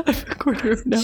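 laughing through the words; giggling while repeating the phrase. Real, not acted. Reader laughing at Bluebeard's odd deadline.

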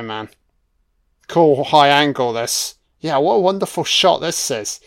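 ordinary delivery

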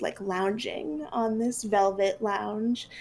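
normal talking voice

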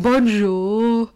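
put-on French greeting in a loud Brummie accent; Birmingham accent. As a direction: belt it out in broad Birmingham. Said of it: "With a Birmingham accent".

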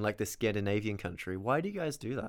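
normal talking voice

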